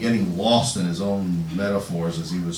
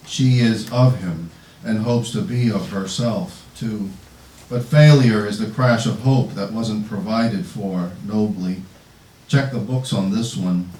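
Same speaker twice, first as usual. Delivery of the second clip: louder, measured poem recitation; poetry reading; 3 semitones higher, 8 dB louder than usual. Acted. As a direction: project each image in deliberate, weighted phrases. Reads own poem aloud.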